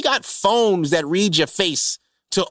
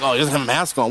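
mock disapproving passenger; 8 semitones lower, faster than usual. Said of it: "looking at me like".

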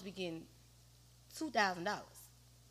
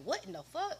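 shocked; 5 semitones higher than usual. Retold or imitated. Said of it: "that was a wake-up call".

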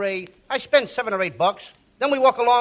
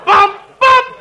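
high, loud bumpy shouts; loud calls; 10 semitones higher, 11 dB louder than usual. Acted. Costello calling out the bumps on the way, high and loud. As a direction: yell each bump like a jolt. Bumping along to the Charm School.

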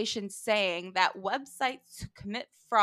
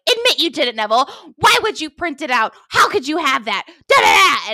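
loud, raised accusing voices; accusing kids; 5 semitones higher, 9 dB louder, faster than usual. Retold or imitated. Kids interrogating Neville, loud accusing. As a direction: hammer him with questions. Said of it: "them being like".

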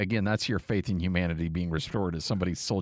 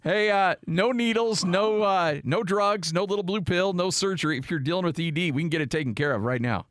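higher, punchy sponsor pitch, settling toward the end; radio ad read; 8 semitones higher than usual. Acted. Host pitches the clinic to listeners, radio ad read. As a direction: launch a live ad with a string of no's.

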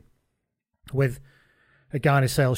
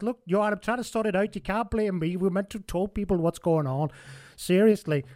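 Humphries voice; 6 semitones higher than usual. Retold or imitated. Humphries pleading in story.

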